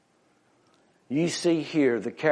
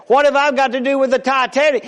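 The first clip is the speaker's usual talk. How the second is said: loud, fast, higher outcry; baffled protest; 9 semitones higher, 7 dB louder, faster than usual. Acted. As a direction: sputter in disbelief. Joke, Chinese man baffled.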